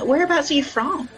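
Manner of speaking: ordinary delivery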